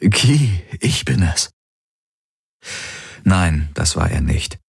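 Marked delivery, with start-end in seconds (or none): 0.1-1.5 higher, urgent pleading; the possessed man begs Kirai to release him. Acted. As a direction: coax her to set him free.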